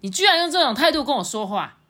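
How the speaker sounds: angry dad raising his voice; 生气大吼. A narrator's reading. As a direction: roar back at your wife, climbing in pitch. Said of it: "就对着象妈大吼说". (爸爸发火吼妈妈)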